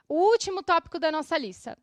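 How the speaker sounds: usual speaking voice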